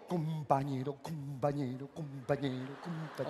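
low, fading echo effect. Retold or imitated. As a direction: let the call echo away. Echoing call.

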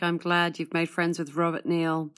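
usual speaking voice

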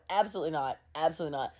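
hushed, dropped-voice refusal; quiet refusal. Real, not acted. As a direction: drop right down and refuse under your breath. Recovering from her own gag.

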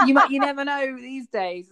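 high, loud talk through laughter; amused, laughter breaking into the words; laughing. Real, not acted. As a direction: keep talking while cracking up. Laughing at own joke.

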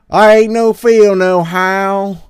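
high, loud, drawn-out fake Southern accent; Hillary Clinton. Hillary Clinton pandering down south, exaggerated fake Southern accent. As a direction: slowly belt out a bad Southern accent. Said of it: "fakes a southern accent".

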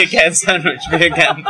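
quick Jamaican "Beer can sandwich", breaking into a laugh; Jamaican accent. Shown as a demonstration. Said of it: "it sounds more Jamaican".

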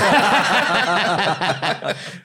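high burst of laughter; loud laugh. Real, not acted. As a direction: crack up loudly. Bursts out at the story.

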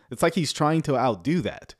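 ordinary talk

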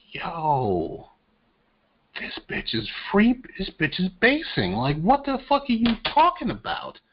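high, exasperated disbelief; incredulous reaction. Retold or imitated. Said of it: "And I was just like".

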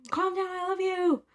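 high, drawn-out mock yell; high-pitched pretend screaming; imitated shouting. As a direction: imitate a slow, strained shout at normal volume. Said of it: "he just keeps shouting".

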